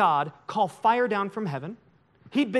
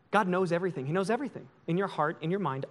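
ordinary talk

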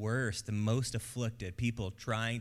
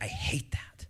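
whispered mock disgust; slower than usual. Crowd mocking a praying player.

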